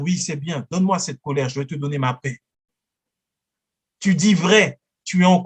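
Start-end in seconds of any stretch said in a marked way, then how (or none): 0.0-2.4 gentle voice of God. Retold or imitated.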